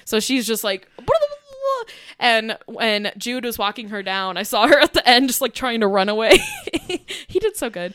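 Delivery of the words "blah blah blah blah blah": very high, goofy puppy gibberish; high silly babble like an excited puppy; imitated puppy babble. Owner imitating puppy going down aisle, goofy babble.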